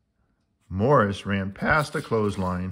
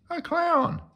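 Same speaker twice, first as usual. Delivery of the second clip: excited. Acted. Explaining clown.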